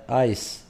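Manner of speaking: ice with an S sound at the end; soft c. Shown as a demonstration. Teacher modelling ice for fourth graders, soft c as S. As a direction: say آيس ending in a clean "s". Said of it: "إذا ننطقها س".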